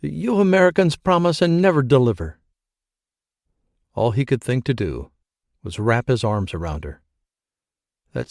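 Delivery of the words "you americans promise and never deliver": bitter accusing. A narrator's reading. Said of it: "She hissed it out like a woman possessed".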